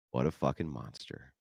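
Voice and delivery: ordinary talk, nothing marked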